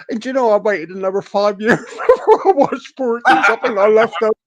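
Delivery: telling it through laughter; breaking up mid-sentence; laughing. Real, not acted.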